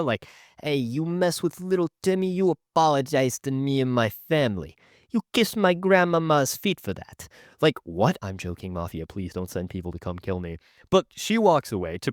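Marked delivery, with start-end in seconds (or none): 0.6-7.3 hammed-up mobster threat; mock mafia boss. Mafia boss demanding an apology for the family, hammed-up. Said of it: "the mafia". 8.2-10.6 low, soft mock plea; hushed aside. YouTuber pleading with the mafia after a joke, hushed.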